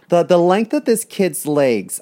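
hammed-up fussy shopper; mock picky buyer. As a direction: inspect the kid like merchandise.

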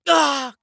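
short high cry of alarm; high acted yell. Character being buried cries out, high acted yell. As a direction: let out a loud, high "ah".

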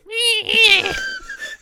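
high, loud screeching cat sound, laughing; cat screech through laughter. Retold or imitated. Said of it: "the cat just looks at me and goes".

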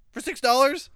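mock-scared, high-pitched question; perturbed. Cashier balking at the drive-thru order, perturbed. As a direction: squeak it out like a rattled cashier. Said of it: "she sounded fucking perturbed ... she was scared".

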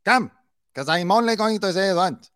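high-pitched, imitated bossy command; mock dictator. Commentator mocking the wife ordering everyone to come, dictator-like. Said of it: "She's like a dictator".

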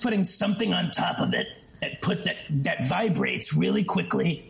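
raspy buzz added on top of the voice; Beetlejuice rasp. Shown as a demonstration. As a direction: lay a rasp over the normal voice. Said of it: "You see how easily I can come in and out of it".

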